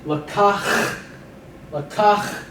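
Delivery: lakach with a rasping fricative ch at the end; lakach, fricative final ch; Hebrew word. Shown as a demonstration. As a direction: say lakach slowly, throaty kh sound. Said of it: "fricative H".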